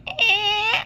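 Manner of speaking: long, high-pitched squeaky creak; imitated door creak. Passenger opening rickety train door, imitated high creak.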